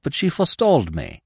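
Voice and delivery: plain reading voice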